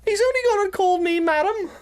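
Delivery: high, laughing sneer called out; mocking customer. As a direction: cackle it over to your mate. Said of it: "shouting down to their mate".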